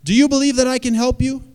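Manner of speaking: big, high, booming call from above; voice from the sky. Acted. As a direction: call down loudly like God from the heavens. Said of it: "A voice came out of the sky".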